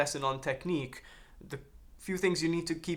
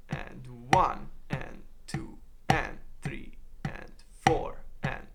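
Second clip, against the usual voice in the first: very slow, drawn-out counting; much slower than usual. Shown as a demonstration. Teacher checks the hand choreography, counting slowly. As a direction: count each beat slowly along with the sticks. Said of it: "Let's check it out slowly".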